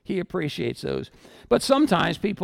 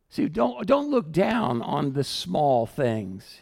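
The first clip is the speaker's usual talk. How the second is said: ordinary talk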